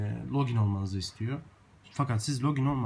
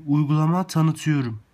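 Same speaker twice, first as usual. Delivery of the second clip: projecting clearly into the phone; loud into the mic; 4 semitones higher, 9 dB louder than usual. Real, not acted. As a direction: speak up for the microphone. Testing the app microphone.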